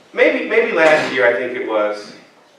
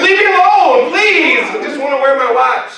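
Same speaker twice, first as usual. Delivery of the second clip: mock whiny plea; 8 semitones higher than usual. Husband whining to his wife about the watch, high pleading. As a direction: beg to be left alone, hammed up.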